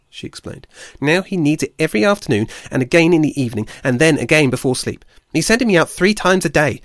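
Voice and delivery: high, loud, fast exasperated complaint; irritated squirrel rant. A narrator's reading. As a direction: vent every grievance at full tilt. Squirrel rants about her duties.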